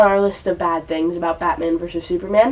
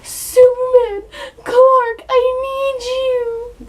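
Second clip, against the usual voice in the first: high, slow, crying mock plea; high weepy damsel voice, dragged out; mock whiny Lois; much slower than usual. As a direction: ham up a tearful damsel begging. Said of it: "she's just like".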